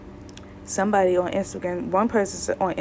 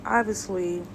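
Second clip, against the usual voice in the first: ordinary talk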